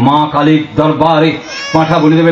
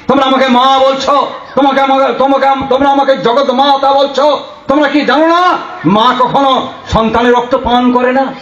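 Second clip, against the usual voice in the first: lightly voiced goddess, pitched higher; a mild, raised-pitch remonstrance to her children; reproachful Mother goddess; 6 semitones higher than usual. Preacher speaking as Mother Kali to her worshippers, mild reproach. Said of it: "মা কি বলছেন".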